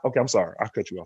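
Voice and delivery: usual speaking voice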